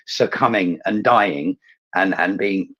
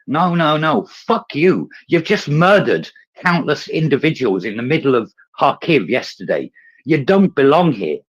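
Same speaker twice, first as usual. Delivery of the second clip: higher, louder furious outburst; real fury; 6 semitones higher, 3 dB louder than usual. Real, not acted. Ranting at Russia over Kharkiv, real furious shouting. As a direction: spit it at them, truly enraged. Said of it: "I'm so angry this morning".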